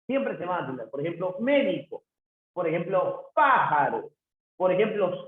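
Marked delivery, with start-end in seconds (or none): 3.3-4.1 pájaro with a loud, punched PÁ; stress on first syllable. Shown as a demonstration. Teacher showing an antepenultimate stress, pájaro stressed hard on PÁ. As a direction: hit the first syllable of pájaro, let the rest drop. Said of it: "la sílaba tónica se encuentra en la antepenúltima".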